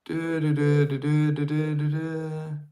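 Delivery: drawn-out monotone du-du-du filler; slow, steady wordless humming syllables; idle du-du-du. Real, not acted. Streamer filling time while picking one, idle du-du-du.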